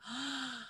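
acted sharp intake of breath; mock horrified gasp; shocked gasp. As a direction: gasp as if hit by the bill. Shopper hears the shocking total.

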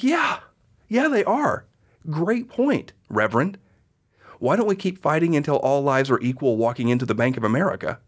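high, mocking "yeah, yeah"; fake-agree, then twist the knife; sarcastic reply to the reverend; sarcastic agreement.

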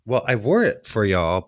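normal talking voice

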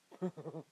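short awkward chuckle; a quick uneasy laugh; nervous laugh. Real, not acted. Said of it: "that's a nervous laugh".

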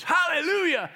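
Hallelujah yelled high and loud; desperate shout. Retold or imitated. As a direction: scream Hallelujah to stop the horse.

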